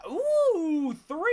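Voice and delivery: loud, high, drawn-out ooh; excited whoop. Real, not acted. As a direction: whoop with delight at a windfall. Delighted at three upgrades.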